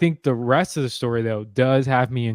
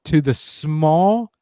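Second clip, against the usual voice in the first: slow emphatic; 3 semitones higher, much slower than usual. Real, not acted. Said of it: "slowed for emphasis".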